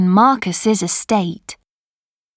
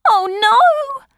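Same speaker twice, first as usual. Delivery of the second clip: much higher, sharp cry of alarm; frightened cry; 13 semitones higher than usual. A narrator's reading. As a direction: gasp it out like a scared girl. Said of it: "cried Maya".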